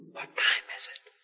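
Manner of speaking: hushed whisper in a chapel pew. Retold or imitated. Leaning over to a friend at a funeral, whispering. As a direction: whisper it so no one hears.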